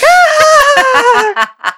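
high thrilled fan squeal; delighted squeal. Retold or imitated. Fans squealing at the singer saying potato, high delighted squeal. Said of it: "And they're like".

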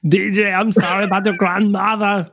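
mock Schwarzenegger accent, laughing; a bad Arnold condolence. Friend apologizing in a bad Schwarzenegger accent. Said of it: "a very bad impression of Arnold Schwarzenegger".

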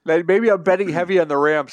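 yawning through the words. Real, not acted. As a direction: talk mid-yawn. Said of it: "Sorry, I'm sorry, I'm yawning tonight".

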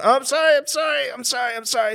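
high, rapid-fire mock apologies; frantic apologizing. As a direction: spam sorry in a panicky high voice. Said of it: "You're going".

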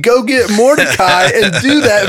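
high, playful royal order; commanding mock king. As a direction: hand down the order with teasing relish. Acts out king ordering Haman.

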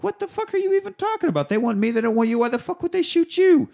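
high, fast mock exasperation; a hammed-up rant, pitched very high; exasperated Sonny. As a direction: squeal the disbelief way up.

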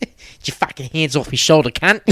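a hint of a drunk Aussie mate; lightly voiced drunk mate; drunk mate snapping. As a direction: snap at your friend to back off. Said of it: "he only called me a C word".

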